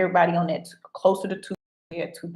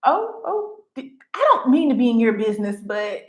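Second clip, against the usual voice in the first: high, hesitant mock politeness; lightly voiced awkward self; my own polite reply, voiced up; 4 semitones higher, 5 dB louder than usual. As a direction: play yourself backing off awkwardly. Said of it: "And I was like".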